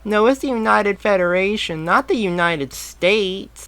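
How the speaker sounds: high amused correction; laughing boy. A narrator's reading. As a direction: correct him through a laugh. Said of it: "the boy laughed again".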